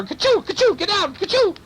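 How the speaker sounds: very high, frantic burglar; sneezing burglar. A narrator's reading. Burglar fends off cat on his leg, frantic sneezing. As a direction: plead between explosive mock sneezes.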